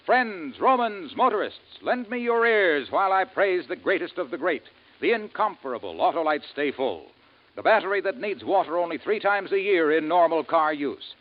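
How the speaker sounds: ordinary talk, nothing marked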